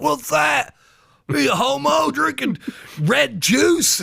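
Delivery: hammed-up jeering loudmouth; mocking heckler. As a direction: shout it high, taunting someone's drink. Acts out heckling bar patrons.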